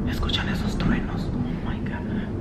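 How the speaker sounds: hushed, unvoiced alarm; awed whisper. Real, not acted. Vlogger asking viewers if they hear thunder, awed whisper. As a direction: break off and whisper, slowing at the end. Thunder interrupts her talk.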